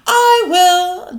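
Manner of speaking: loud, high, proud I will; eager declaration. Acted. Little dragon to family, eagerly declaring. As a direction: announce it with all your chest. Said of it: "declared Crispin".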